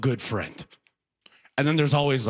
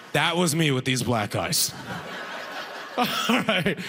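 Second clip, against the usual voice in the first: ordinary delivery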